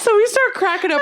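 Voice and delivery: high, laughing through the words. Real, not acted. To her cohost, retelling the work story while laughing. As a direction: still cracking up at the memory.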